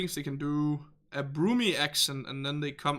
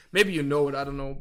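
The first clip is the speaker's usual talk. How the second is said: ordinary delivery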